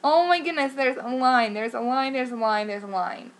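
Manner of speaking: ordinary talk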